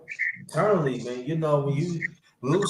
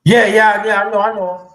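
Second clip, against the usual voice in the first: eager agreement; 6 semitones higher, 11 dB louder than usual. Real, not acted. Eager agreement about the loss.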